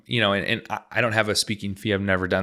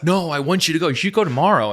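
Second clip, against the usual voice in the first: higher and louder, keenly urging him on; eager wife; 7 semitones higher, 4 dB louder than usual. Retold or imitated. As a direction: play the wife pushing him out the door.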